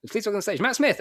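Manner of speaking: soaring high compere announcement; announcer build-up. Retold or imitated. Compere hyping the crowd for Matt Smith, rising high announcement. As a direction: build it up like a big stage intro. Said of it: "they were like".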